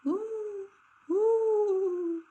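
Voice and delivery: high, drawn-out eerie hoot; imagined banshee cry, high and long; ghostly woo. A narrator's reading. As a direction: call it like a haunting cry. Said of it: "the cry".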